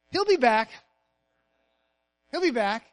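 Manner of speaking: normal talking voice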